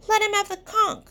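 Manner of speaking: high, loud indignant cry; shout. Acted. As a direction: yell over the laughing crowd. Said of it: "shouted Piggy".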